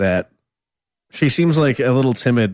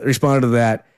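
ordinary talk

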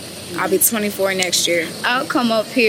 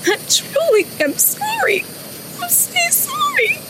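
high, broken, sobbing through words; crying out love and sorry; tearful goodbye; 8 semitones higher, 4 dB quieter than usual. Real, not acted. Daughter's last goodbye to father.

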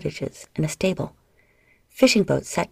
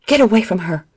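louder shout, voice strained; protective shout; 2 semitones higher, 6 dB louder than usual. A narrator's reading. Adia shouting at slaves grabbing her sister, loud. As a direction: push them off with your voice.

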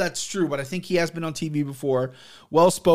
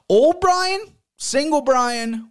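much higher, louder call; playful exclamation; 11 semitones higher, 3 dB louder, much slower than usual. Real, not acted. Telling viewers about his younger self, high and loud. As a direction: announce it with a grin.

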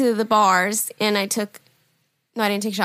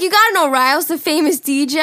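excited boast; 5 semitones higher, 5 dB louder than usual. Retold or imitated. Kid boasting at bar.

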